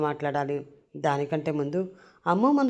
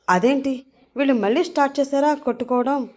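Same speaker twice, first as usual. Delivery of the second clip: higher-pitched, mildly alarmed question; alarmed dismay; 7 semitones higher, 3 dB louder than usual. Acted. Sister seeing the two men fight again, alarmed. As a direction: raise the pitch in dismayed disbelief.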